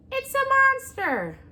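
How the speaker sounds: high, loud, slow screech of alarm. A narrator's reading. Owl screeching at the robot, shrill and loud. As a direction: shriek it in panic.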